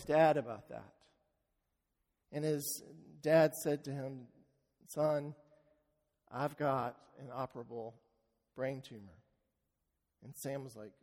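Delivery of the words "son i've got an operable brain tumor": soft and low, breaking bad news gently; a father's hushed confession; quiet grave news. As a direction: tell him softly, heavy-hearted. Father reveals tumor to son.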